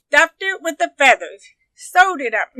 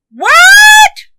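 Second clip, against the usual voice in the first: very high, loud shriek of surprise; granny's startled high yelp; shocked cry; 12 dB louder than usual. A narrator's reading. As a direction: shriek it in utter disbelief. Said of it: "cried Granny".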